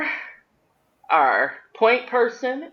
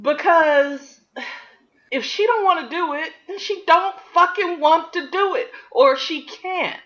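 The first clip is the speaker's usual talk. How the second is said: heated; 13 semitones higher than usual. Real, not acted. Heated rant.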